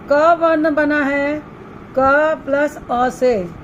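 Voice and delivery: slow, deliberate classroom spelling; teacher's slow dictation. Shown as a demonstration. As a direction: break the letter apart slowly for children. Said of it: "teacher spells out letters".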